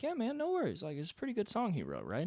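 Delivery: high, easygoing dude voice; laid-back God voice. Retold or imitated. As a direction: play God as a chill guy. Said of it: "God would be cool enough to be like".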